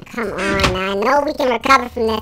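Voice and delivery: low, soft grumbling to yourself; dejected. Real, not acted. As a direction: sag after losing the last match. Dejected after a lost match.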